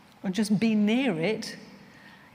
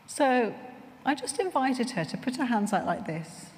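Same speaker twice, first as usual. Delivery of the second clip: ordinary talk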